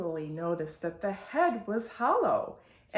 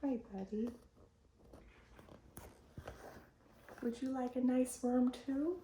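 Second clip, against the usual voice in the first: much softer, gently to the little one; 4 semitones higher, 9 dB quieter than usual. Real, not acted. Mother greeting toddler Onyx during story time, soft and gentle. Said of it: "Onyx here who wants to join".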